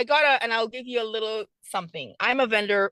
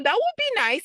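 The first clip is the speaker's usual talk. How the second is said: much higher, bright with delight; barely containing excitement; thrilled reply; 11 semitones higher than usual. Retold or imitated. Telling the buyer she'd love to meet, excited.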